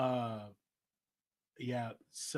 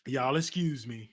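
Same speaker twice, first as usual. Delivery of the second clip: ordinary delivery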